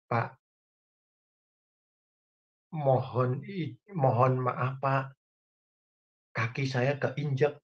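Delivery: soft-spoken man whose foot was stepped on, politely complaining; gentle polite Javanese. Retold or imitated. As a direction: imitate a mild Javanese man apologising softly. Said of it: "berbicaranya lemah lembut".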